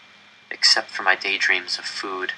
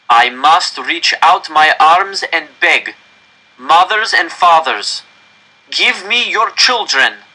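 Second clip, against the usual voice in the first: slow, raised, higher begging address; pleading official; 7 semitones higher, 6 dB louder, slower than usual. Acted. Director begging mothers and fathers for their children, slow and raised. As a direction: beg the parents from the stage.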